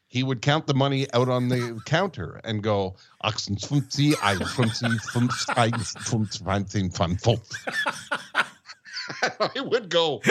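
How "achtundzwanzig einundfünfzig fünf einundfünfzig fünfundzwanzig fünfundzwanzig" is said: mimicking a German shopkeeper counting, through laughter; lightly imitated German counting, laughing. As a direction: rattle off German numbers, half laughing. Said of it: "he was so used to counting in German".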